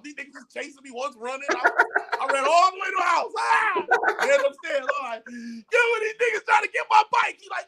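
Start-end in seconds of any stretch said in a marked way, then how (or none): 3.4-3.9 put-on high, loud kid's scream; retold scream. Chased boy running home to big brother, high mock scream. 5.3-7.4 put-on high, loud kid calling for help; frantic shouting. Boy telling big brother about bike thieves, frantic shout.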